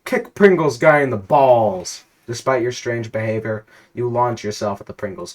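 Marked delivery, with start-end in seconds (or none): 0.0-2.0 forced loud yell. Acted. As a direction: shout it, slow and much louder. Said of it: "force out the words".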